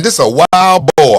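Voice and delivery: hammed-up excited realization. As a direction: spell it out like you just figured it out. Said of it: "You saying".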